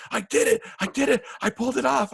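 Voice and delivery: put-on very high, thrilled shouting of a kid who pulled it off; excited yell. Young star backstage yelling his triumph to the crew. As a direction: squeal it out like you just nailed it. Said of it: "he goes".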